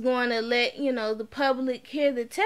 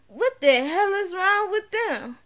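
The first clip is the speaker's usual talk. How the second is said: like you can't believe what you're seeing; incredulous aside; 6 semitones higher than usual. Retold or imitated. Courtroom watching lawyers bicker, disbelief.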